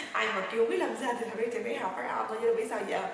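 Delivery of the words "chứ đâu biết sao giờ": ordinary talk, nothing marked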